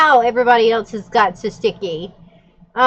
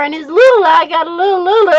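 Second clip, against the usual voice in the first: laughing; 5 semitones higher, 4 dB louder, faster than usual. Real, not acted. Laughing through the description.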